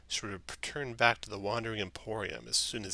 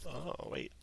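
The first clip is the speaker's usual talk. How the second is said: ordinary delivery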